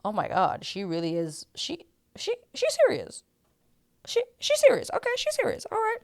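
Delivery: halting disbelief, jumping up into a high squeak; squeaky, broken-up mock shock; stunned realisation. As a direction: stammer it, taken aback by how serious she is. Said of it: "And I'm like".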